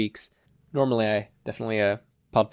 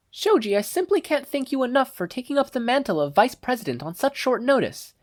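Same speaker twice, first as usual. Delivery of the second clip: put-on much higher, refined young lady; Momo's voice. Momo thanking Shoji, high refined exclaiming voice. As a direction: gush thanks like a polite class president. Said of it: "Momo exclaimed".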